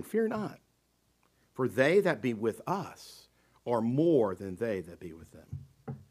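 soft, low, calm "Fear not" fading away; calm reassurance. Shown as a demonstration. Elisha calmly reassuring his frightened servant. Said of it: "very calmly given".

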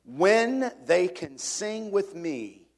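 put-on a preacher savouring an old hymn verse; reverent hymn recitation. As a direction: linger on every word of the stanza.

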